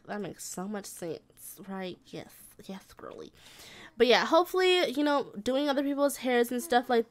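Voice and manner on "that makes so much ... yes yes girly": breathy little whisper. Real, not acted. Tiny whispered aside to the Sim girl, whispered. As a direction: drop to a whisper, just for her.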